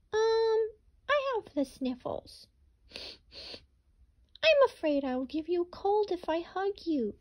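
high apologetic rabbit backing away from Hedgehog; sniffly rabbit. Acted. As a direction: squeak it out like a timid sick rabbit. Rabbit voiced again.

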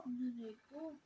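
usual speaking voice

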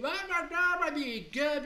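very loud, high garbled roar; mock raging yell; hammed-up bawling. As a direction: bellow like an old lord throwing a fit. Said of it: "il se met à gueuler".

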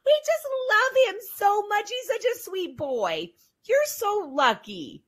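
put-on high, loud, gushing old lady voice; gushing old church ladies. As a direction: coo over him like a doting church lady. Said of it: "the old ladies ... they'd be like".

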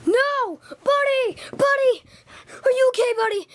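high, loud cries for a hurt friend; panicked calling. Acted. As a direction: call out to him in alarm.

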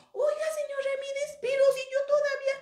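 high-pitched, incredulous protest; astonished boy. Acted. Toñito questioning señor Ramírez about his bill, astonished and high. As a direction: play a stunned kid who can't believe it. Boy learns debt is paid.

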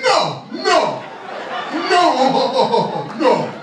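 hammed-up African man, pitched high; African accent. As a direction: reject him outright in a mock African accent. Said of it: "my brothers from Africa".

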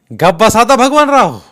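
very loud, high shut-up command; angry order. Acted. Deshpande silencing Bhagwanrao, angry shouted order. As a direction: cut him off hard.

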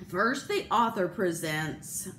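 usual speaking voice

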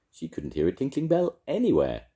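ordinary delivery, nothing marked

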